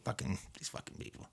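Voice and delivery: whispered grumble under the breath; muttered exasperation. Retold or imitated. Coworker grumbling to clerk about customers, whispered. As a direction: imitate a coworker cursing in a whisper.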